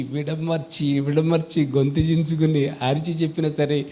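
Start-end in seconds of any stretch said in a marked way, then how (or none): none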